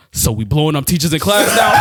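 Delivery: furious African dad on speakerphone. Retold or imitated. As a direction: boom it out like a strict African dad. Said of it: "my dad, he's African".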